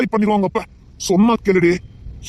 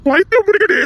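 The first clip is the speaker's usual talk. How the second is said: angry shout; 4 semitones higher than usual. Acted. Husband threatens wife in anger.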